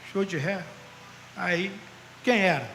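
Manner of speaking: usual speaking voice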